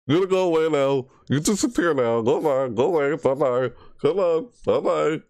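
high coaxing go-away singsong; high shooing voice. Real, not acted. Gamer shooing the monster away, high coaxing voice.

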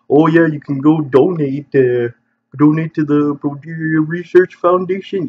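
high, bright pitchman sales voice; infomercial voice. Pretend. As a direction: sell it like a cheesy TV ad. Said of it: "sounding like an infomercial saying".

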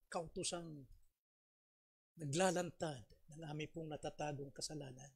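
a pastor praying gently to close the sermon; closing prayer. Acted. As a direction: pray quietly for the listeners.

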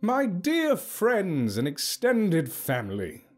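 slow, high ceremonial address; calling out. A narrator's reading. Hook addressing the tribe's circle, calling out. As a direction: call grandly to the gathered circle. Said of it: "he called out to the circle".

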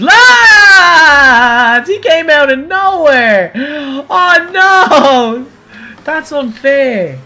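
high, loud outraged yells; shocked shouting. Real, not acted. Gamer yelling at a surprise crash, loud and high. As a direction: shout at the car that came from nowhere. Car pops out during race.

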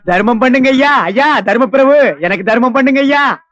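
slow, drawn-out call for alms; beggar's plea. Acted. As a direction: beg passers-by with long trailing vowels. Begging passers-by for alms.

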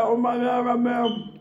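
put-on half-yelled Oh my God at her boyfriend; excited shout. Before the jump, shouting to Ramel in excitement. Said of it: "And I was like".

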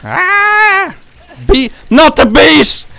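high, slow anguished wail of the bees line; Wicker Man bees scream. Retold or imitated. Gamer quoting the Wicker Man, slow high anguished wail. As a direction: howl it like Cage begging about bees.

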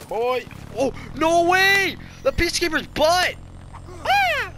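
loud, high screaming protest; outraged yell. Real, not acted. Gamer rages that the Peacekeeper's butt, high outraged yell. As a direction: yell in disbelief, rising to a shriek. Dying with a disliked gun.

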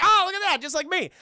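excited. Real, not acted. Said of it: "I was like".

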